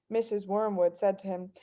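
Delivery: usual speaking voice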